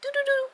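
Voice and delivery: high sung ringing tone; imitating a phone ringing, high; phone ringing hum. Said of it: "I'm about to reenact it".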